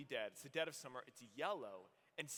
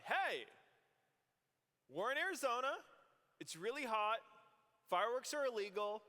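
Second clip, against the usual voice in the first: higher, louder kid pitching a bad idea; excited kid; 6 semitones higher, 4 dB louder than usual. Little brother eagerly pitching fireworks to T-Bone, excited and louder. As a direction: hype up the plan like a reckless boy. Kids hatch a fireworks plan.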